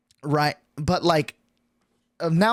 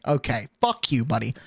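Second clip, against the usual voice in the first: normal talking voice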